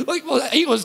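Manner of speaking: ordinary talk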